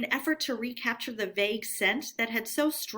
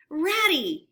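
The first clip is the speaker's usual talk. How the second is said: calling out high and loud with joy; joyful excited call; 5 semitones higher, 5 dB louder than usual. Acted. Mole calls ahead to Rat, joyful and excited. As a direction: shout his friend's name, thrilled. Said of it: "full of joyful excitement".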